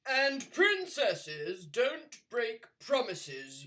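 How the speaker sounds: slow, loud booming king; bellowing king. Acted. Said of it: "bellowed the king".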